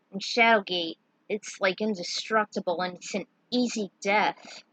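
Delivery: plain reading voice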